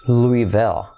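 LOUISVILLE as "LOO-ee-vul", silent S; correct English pronunciation. Shown as a demonstration. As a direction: model LOUISVILLE slowly, no S. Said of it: "se pronuncia".

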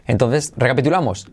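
ordinary talk, nothing marked

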